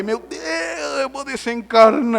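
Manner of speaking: high, drawn-out comic wail; hammed-up doomed cry; mock drowning wail. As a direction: wail as if going under.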